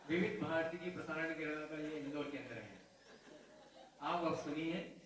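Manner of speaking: a hint of a radio announcement; lightly voiced radio announcer. Preacher mimicking the night radio programme, lightly announced. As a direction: read it like an old radio anchor.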